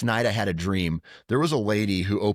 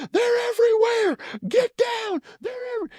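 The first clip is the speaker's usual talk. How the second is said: very high, frantic war cry; grandpa in flashback. Retold or imitated. Grandpa reliving Korea, yelling frantic high warning. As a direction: shout it like a soldier under fire. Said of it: "He's like".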